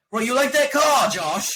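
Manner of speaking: put-on high, loud over-the-top British accent; mock a terrible British movie accent; Hugh Grant's ridiculous accent. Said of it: "his accent's ridiculous through the whole movie".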